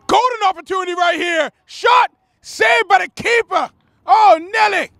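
high, shouted soccer call; excited play-by-play. Real, not acted. Commentator calling a shot saved by the keeper, excited shout. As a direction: call the chance at full excitement, pitch way up.

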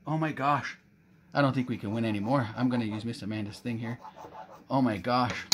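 ordinary talk

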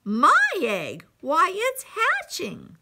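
storybook elephant bursting with surprise; amazed. A narrator's reading. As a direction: marvel out loud in disbelief. Horton amazed his egg hatches.

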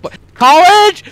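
drawn-out, high-pitched yell; exasperated yell. Real, not acted. Player blames teammate College, exasperated yell. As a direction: yell it out, stretching the words.

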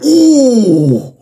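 loud, high falling "ooh"; mimicked "ooh". Viewer imitating someone's reaction to the play, loud mock ooh. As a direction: hoot a long ooh, high then dropping. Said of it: "he was going".

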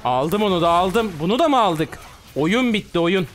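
high and loud triumphant calls; excited celebration. Real, not acted. Streamer celebrating kills to chat, loud and high. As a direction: cheer each kill.

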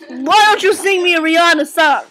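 loud, high, demanding impression. Impersonating a friend who demands a Rihanna song, shouted impression. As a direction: holler it as a pushy request. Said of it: "that was my impression of [name]".

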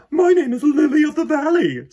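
put-on high, loud pleading girl; eager girl. A narrator's reading. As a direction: beg to be a flower too. Victoria claims flower name.

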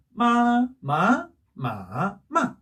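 "마" four times: level, rising, dipping, falling; four tones. Shown as a demonstration. Teacher recapping all tones, "마" in four pitch shapes. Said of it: "이거였었죠".

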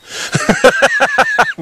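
giggling shriek; high squealing laugh. Real, not acted. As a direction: let a squeaky laugh burst out. Laughing again.

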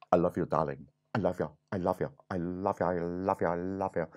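low, lovestruck prince voice; hammed-up doting prince; storybook prince. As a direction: woo the princess in a low, repetitive murmur. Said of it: "And the prince says".

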